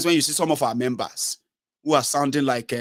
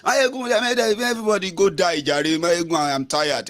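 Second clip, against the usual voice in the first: higher, dismissive mock voice; mocking a tired critic; 5 semitones higher than usual. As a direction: play the fed-up critic, higher pitched. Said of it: "And he said".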